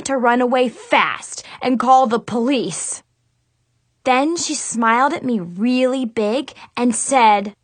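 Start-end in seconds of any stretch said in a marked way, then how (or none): none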